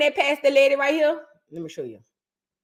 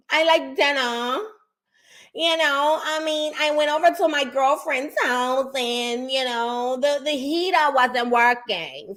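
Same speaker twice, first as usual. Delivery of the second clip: lightly voiced New York accent; New York accent impression. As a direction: play a chatty New York lady, slowly. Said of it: "try to get y'all accent down".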